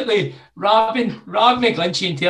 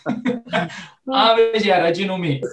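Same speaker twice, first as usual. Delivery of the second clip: laughing; 2 semitones higher, slower than usual. Real, not acted. Boasting laughingly.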